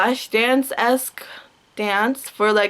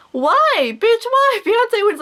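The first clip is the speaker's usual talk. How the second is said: much higher and louder, incredulous; outraged high yell; 12 semitones higher, 3 dB louder, slower than usual. Real, not acted. Ranting at [name] over [name]'s wins, high and loud. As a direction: shriek the question at him.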